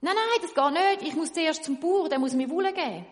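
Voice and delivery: loud, high insistent no; loud refusal. Acted. Weaver refusing the tailor, loud protest. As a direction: refuse firmly and loudly.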